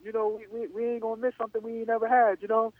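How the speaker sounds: a high, brash father brushing off the money; father's voice. Retold or imitated. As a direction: play the dad arguing to go for the NFL. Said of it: "My father was like".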